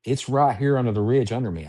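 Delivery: ordinary delivery, nothing marked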